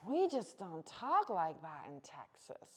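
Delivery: slow, hammed-up Texan drawl; lazy mock Texan drawl, slow and loud. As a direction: drag it out like a Texas farmer. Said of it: "the way he put it was like".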